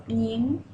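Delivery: "ning" with a rising second tone. Shown as a demonstration. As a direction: glide "ning" upward, second tone. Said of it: "it is the second tone".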